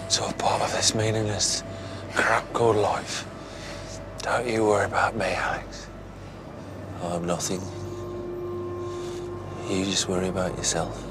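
weak whisper. Acted. Injured Gil whispering to Alex from the ground. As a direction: barely get the words out. Injured man speaks weakly.

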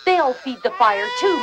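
normal talking voice

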